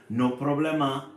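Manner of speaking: mildly squeaky frog voice; squeaking. A narrator's reading. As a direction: squeak it lightly as a little frog. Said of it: "Froggy squeaks".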